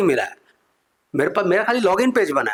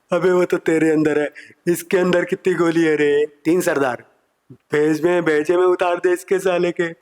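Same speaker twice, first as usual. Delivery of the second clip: Gabbar Singh imitation. Gabbar orders a shot to the head, mock bandit growl. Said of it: "Gabbar Singh बैठे हुए थे".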